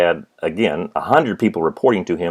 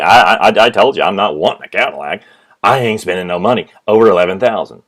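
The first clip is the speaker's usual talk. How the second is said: louder, faster insistence; stubborn refusal; 7 dB louder than usual. Retold or imitated. Father rejecting the Cadillac to the salesperson, loud and insistent. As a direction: shut the salesman down hard.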